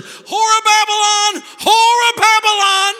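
high, slow, shouted condemnation; retold outraged shout. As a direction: bellow it like a street preacher. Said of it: "he stood up and said".

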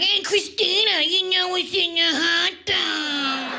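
high teasing buddy voice; annoying friend. Retold or imitated. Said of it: "You got that friend that's like".

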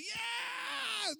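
long, very high cheering shout; crowd yelling. Retold or imitated. Preacher imitating all the passengers, high drawn-out yell. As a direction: imitate a whole crowd whooping. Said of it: "gente comenzó a gritar".

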